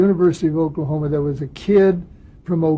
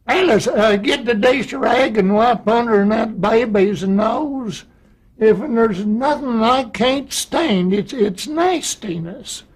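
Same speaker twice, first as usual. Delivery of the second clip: higher, slow, thick Oklahoma country drawl; thick Oklahoma accent; 6 semitones higher, slower than usual. Acted. Actor imitating a college kid at parties, thick Oklahoma accent. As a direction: imitate a hard-to-understand Oklahoma country kid, stretching the words. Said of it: "He had such a thick accent".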